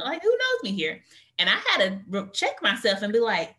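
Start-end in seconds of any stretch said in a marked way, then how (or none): 0.2-1.0 high startled blurt, pitch jumping way up; a jolted who-knows-me cry. Retold or imitated. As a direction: caught off guard and alarmed. Startled retold reaction.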